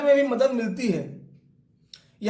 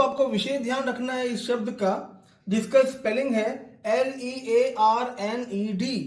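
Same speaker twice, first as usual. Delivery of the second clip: ordinary delivery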